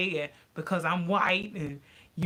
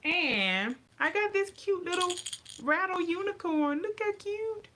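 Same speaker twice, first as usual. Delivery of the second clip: cutesy voice; 11 semitones higher than usual. Real, not acted. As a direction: coo over the cute toy. Showing off a cute toy.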